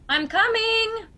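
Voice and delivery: high, drawn-out call toward the door; elderly aunt calling across the house, slowed. Acted. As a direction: sing out to whoever's knocking. Abby calls to the door.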